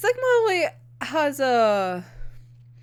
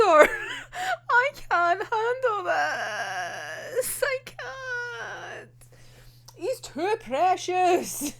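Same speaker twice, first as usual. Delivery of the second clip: high, drawn-out tearful whine; mock sobbing; 7 semitones higher, much slower than usual. Overwhelmed fan over a precious character, mock-tearful wail. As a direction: wail it slowly, playfully overwhelmed.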